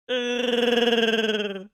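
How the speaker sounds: long, high trilled roar; a sustained rolling Wookiee call; Chewbacca. Acted. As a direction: roll the tongue through one long creature cry. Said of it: "I'm just gonna do my Chewbacca".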